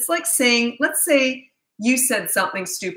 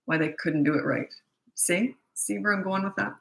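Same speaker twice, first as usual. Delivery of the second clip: normal talking voice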